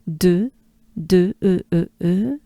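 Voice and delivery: de with a rounded "uh", mouth pushed forward; short repeated de and E, like French "deu". Shown as a demonstration. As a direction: drill de, then E alone, jaw and lips forward. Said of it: "Move your jaws, your mouth forward".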